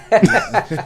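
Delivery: high, bright laugh; quick laugh at own joke. Real, not acted.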